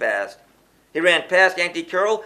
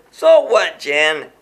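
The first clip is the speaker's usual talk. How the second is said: defiant yell; 6 dB louder, slower than usual. Acted. Elroy yelling at Jen, mocking the town rule, defiant shout. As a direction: shout it back laughing at the rule. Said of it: "as he yelled".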